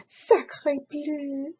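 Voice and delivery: duck voice with a cry in it. Acted. Duck complains about the chicken, tearful. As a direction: complain like a put-upon duck. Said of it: "cried the duck".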